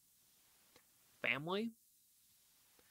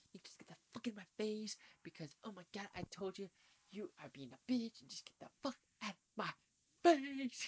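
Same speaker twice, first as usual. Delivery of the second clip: higher, fast mock movie meltdown, ending in a yell; Nicolas Cage impression; 7 semitones higher than usual. Reviewer imitating Cage ranting in a movie, hammed-up yelling. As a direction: ham up an unhinged actor ranting in someone's face. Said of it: "I'm doing the whole Nicolas Cage right there".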